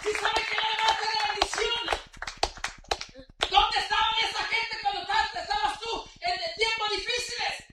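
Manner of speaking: loud, high, driving sermon; preaching at full force. Acted. Preacher rebuking lukewarm believers, full-force preaching. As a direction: exhort them to come to church.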